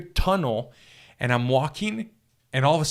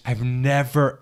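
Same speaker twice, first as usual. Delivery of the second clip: usual speaking voice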